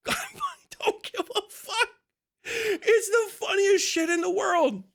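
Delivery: can't stop laughing at yourself. Real, not acted. Streamer to chat, laughing helplessly. Laughing at his own rant.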